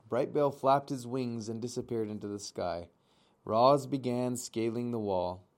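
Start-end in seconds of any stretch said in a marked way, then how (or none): none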